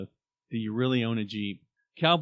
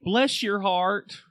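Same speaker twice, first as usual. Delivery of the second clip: mock southern sweetness; 9 semitones higher, 5 dB louder, much slower than usual. Acting a southern saying.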